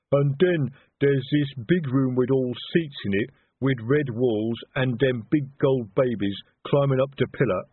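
slow, lumbering uncultured explainer; Detritus, expansive troll. A narrator's reading. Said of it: "heard Detritus's voice in full, expansive explanation".

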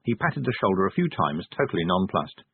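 usual speaking voice

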